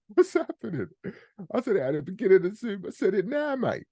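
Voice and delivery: very high, squeaky complaint; mock exasperation. Host telling Martin it was said at the start, squeaky mock exasperation. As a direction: protest in a comic falsetto squeal.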